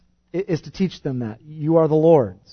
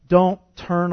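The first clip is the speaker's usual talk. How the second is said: "Don't turn" with DON'T stressed; emphasized don't; 2 semitones higher than usual. Shown as a demonstration. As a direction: hit the word DON'T. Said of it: "he keeps, uh, emphasizing".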